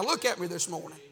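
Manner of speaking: usual speaking voice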